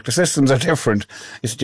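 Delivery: ordinary talk, nothing marked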